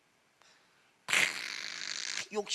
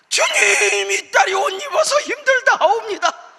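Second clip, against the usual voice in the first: much higher and louder, laughing through a mock prayer; 10 dB louder than usual. Pastor mock-prays over the member's silly complaint, laughing. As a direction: ham up a solemn prayer while cracking up.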